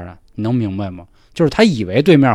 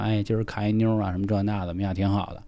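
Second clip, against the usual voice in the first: low murmured small talk in the dark; hushed chat; 4 semitones lower, 10 dB quieter than usual. Retold or imitated. Two boys in the toilet chatting softly, hushed. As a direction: keep it quiet so the light stays off. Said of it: "声音很小".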